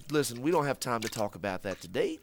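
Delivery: ordinary talk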